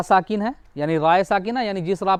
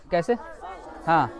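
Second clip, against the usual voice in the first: ordinary talk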